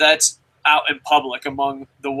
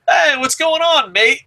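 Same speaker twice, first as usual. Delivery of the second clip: imitating a broad Australian greeting, much higher and louder; playful Australian impression, high and loud; mock Australian; 9 semitones higher, 4 dB louder than usual. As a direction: ham up an Australian mate accent, pitched high. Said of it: "'cause they're like".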